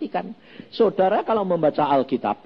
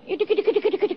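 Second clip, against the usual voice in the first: high mimicking sound; 14 semitones higher, much faster than usual. Imitating a woman's swaying walk.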